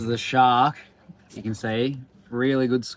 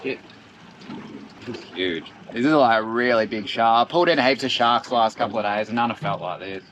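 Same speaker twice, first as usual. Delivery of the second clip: ordinary talk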